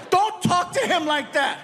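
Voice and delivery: high, protective mom voice; mom scolding. Retold or imitated. Mom defending her son from the dad, high scolding voice. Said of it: "His mom didn't like that".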